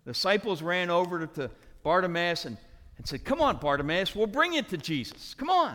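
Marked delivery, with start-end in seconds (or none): 3.2-5.7 eager encouragement. Acted. Disciples supposedly helping the beggar, acted.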